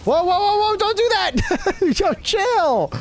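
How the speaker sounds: high, loud panicked whoas; alarmed shouting. Real, not acted. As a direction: yell at a teammate to stop. Teammate about to blow things up.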